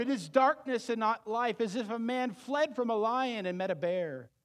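loud, high fervent preaching; preaching at full force. Acted. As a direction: drive the warning home to the congregation. Preaches God's warning.

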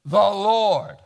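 loud, weighty answer; firm declaration. Retold or imitated. Abraham answering the servant, loud and firm. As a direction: land it with finality. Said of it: "Abraham says".

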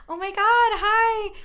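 very high, loud, drawn-out greeting; voice shooting up. Retold or imitated. Student phoning strangers about housing, voice shooting way up. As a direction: gush it in a high-pitched phone voice. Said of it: "my voice would go up".

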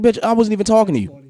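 high, loud retort in a replayed argument; retold indignant comeback. Retelling his comeback in an argument.

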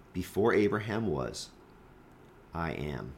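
hushed, dropping low on "I am"; pause, then land it low and soft; solemn declaration. Acted. Jesus' climactic declaration, acted solemnly.

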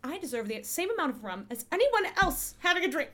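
fast, loud rant breaking into laughter; laughing rant. Acted. Player laughing while demanding as much rum as everyone else. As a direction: demand your fair share through laughs. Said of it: "I'm like".

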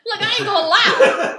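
loud, slow laughing confession. Real, not acted. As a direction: laugh through the words.

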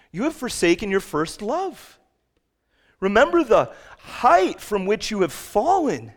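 fervent preacher voice; preaching at full force. Acted. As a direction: thunder the warning. Jesus' rebuke read out.

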